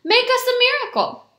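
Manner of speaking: high, loud challenge; calling out. A narrator's reading. Someone in the crowd challenging the beggar, calling out. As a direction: call out a demand. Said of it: "someone called out".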